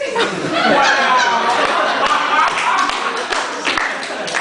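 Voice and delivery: long high wheezing laugh that rises; helpless loud laugh; breathless laugh. Real, not acted. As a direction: crack up completely. Laughs at the double insult.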